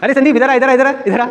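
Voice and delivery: high-pitched imitation of an aunt; aunt calling out. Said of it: "मेरी मामी आवाज़ लगाती थी".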